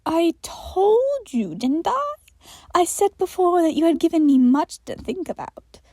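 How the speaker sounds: sinister creature explaining; creepy entity. Acted. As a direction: explain with unsettling patience. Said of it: "Michael explained further".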